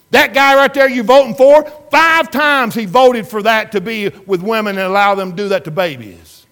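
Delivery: loud, high, relentless pulpit tirade; full-force preaching. Acted. Preacher retelling his rebuke to the congregation, loud and relentless. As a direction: hammer every word at the woman.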